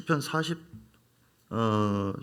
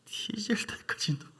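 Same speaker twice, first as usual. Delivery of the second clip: Jeolla dialect; 8 semitones higher, 6 dB quieter than usual. Retold or imitated. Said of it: "전라도 말로".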